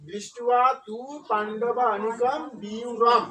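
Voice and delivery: দৃষ্টুয়া তু পাণ্ডবা অনিকম বি রম slowly, part by part; measured word-by-word reading of দৃষ্টুয়া তু পাণ্ডবা অনিকম বি রম; model recitation. Shown as a demonstration. Teacher putting the verse together for students, slow model pronunciation. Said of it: "তাহলে উচ্চারণ কি হলো".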